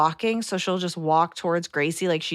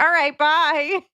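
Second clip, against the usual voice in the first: high, louder, mock-offended bye; mock huffy goodbye; 10 semitones higher, 5 dB louder, slower than usual. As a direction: flounce off in a sulk. Miffed exit to parents.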